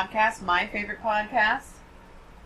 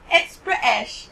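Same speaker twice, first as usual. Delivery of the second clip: put-on British accent; 4 semitones higher, 3 dB louder, slower than usual. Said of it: "You like my accent?".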